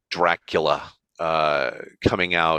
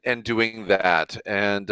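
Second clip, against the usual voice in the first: ordinary delivery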